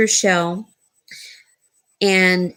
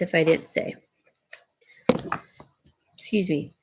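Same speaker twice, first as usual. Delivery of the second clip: talking through a yawn; 4 semitones lower, 6 dB quieter, faster than usual. Real, not acted. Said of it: "I don't know why I'm yawning".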